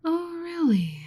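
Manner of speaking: high, bright mock surprise; surprised. Researcher reacting to the adventurer's reply, surprised. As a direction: act taken aback.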